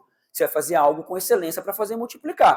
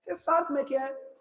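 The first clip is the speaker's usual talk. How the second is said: knowing tease; 9 semitones higher than usual. Real, not acted. Preacher teasing congregation knowingly.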